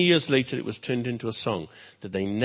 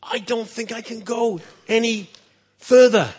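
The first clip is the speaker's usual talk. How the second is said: high, louder pleading call; pleading shout; 9 semitones higher, 7 dB louder than usual. Acted. Tired page begging the king to stop, shouted high. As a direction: cry out to him, worn out and begging. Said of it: "he shouted through the wind".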